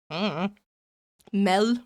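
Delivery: normal talking voice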